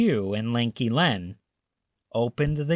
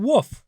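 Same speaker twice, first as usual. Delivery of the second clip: high, louder bark; dog woof; 10 semitones higher, 6 dB louder than usual. A narrator's reading. Reading to children, a loud high dog woof. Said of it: "said the dog".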